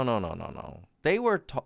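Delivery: ordinary talk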